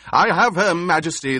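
loud, high triumphant shout. A narrator's reading. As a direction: bellow it to the Emperor. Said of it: "the Baron shouted".

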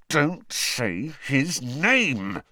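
slow tense hiss through clenched teeth; hissed through gritted teeth. Acted. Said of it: "Ron hissed through gritted teeth".

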